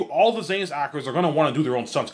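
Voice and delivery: ordinary delivery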